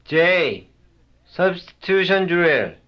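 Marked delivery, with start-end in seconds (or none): none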